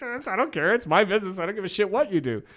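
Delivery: hammed-up dismissive boss. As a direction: brush him off as the arrogant boss would. Boss voiced in retold quarrel.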